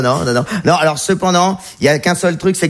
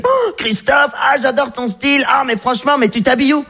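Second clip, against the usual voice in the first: voix plus aiguë de copines admiratives; copines imitées; 8 semitones higher than usual. Retold or imitated. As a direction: imite des copines en extase, plus haut. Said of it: "mes copines me disent". (copines enthousiastes imitées d'une voix plus aiguë)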